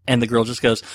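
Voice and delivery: normal talking voice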